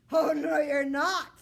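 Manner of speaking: loud, slow grumpy king; a cranky king refusing his son's marriage; angry refusal. Acted. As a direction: put your foot down, hammed up. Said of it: "said the king with a terrible temper".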